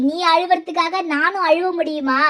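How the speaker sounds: normal talking voice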